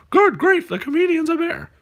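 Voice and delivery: high cartoon take on the line; puppet punchline voice. Shown as a demonstration. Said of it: "you're supposed to go".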